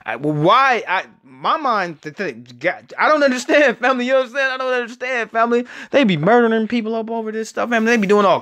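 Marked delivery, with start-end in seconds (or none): none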